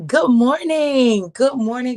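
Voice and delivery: loud, slow, stretched greeting; loud fast street hustling. Real, not acted. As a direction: belt the greeting out long. Greeting viewers loudly, drawn out.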